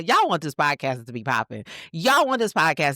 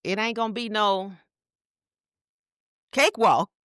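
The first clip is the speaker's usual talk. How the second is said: sassy; 7 semitones higher than usual. Real, not acted. Sassy invitation to Kamala.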